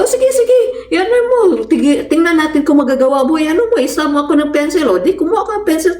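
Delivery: put-on taunting boy. Classmate taunting Marilyn to stab him, high mocking dare. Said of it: "sabi nung nasa likod".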